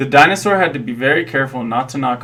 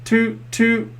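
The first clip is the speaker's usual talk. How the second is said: high, sung-out toots like a boat horn; 10 semitones higher than usual. A narrator's reading. The boats tooting on the river, high horn imitation. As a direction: toot like a little tugboat. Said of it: "went the boats".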